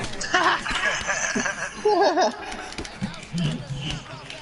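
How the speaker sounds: high-pitched, drawn-out laugh; long high laugh. Real, not acted. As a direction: laugh long and high at your own joke. Laughs at own cheeky joke.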